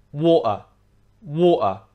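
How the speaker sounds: water with the T replaced by a glottal stop. Shown as a demonstration. Teacher demonstrating the glottal stop in water, T dropped. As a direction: drop the T in water, stop the air. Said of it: "take away the T and we restrict the flow of air".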